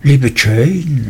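a hint of a dismayed stammer on Liebe Jane; mild nervous stammer on Liebe Jane, falling at the end. A narrator's reading. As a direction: falter over Liebe Jane, caught off guard. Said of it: "stammelte meine Mutter bestürzt".

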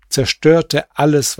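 plain reading voice

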